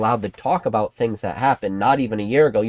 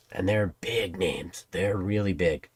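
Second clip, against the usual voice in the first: hushed; 4 semitones lower, 8 dB quieter, slower than usual. Acted. Hushed secret reveal.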